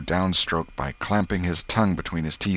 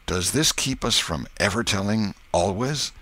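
plain reading voice

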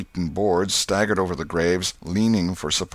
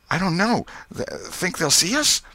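put-on much higher, anxious boy's voice; scared and unsure; frightened boy; 12 semitones higher than usual. A narrator's reading. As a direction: stammer with high-pitched nerves. Tom frightened in the graveyard.

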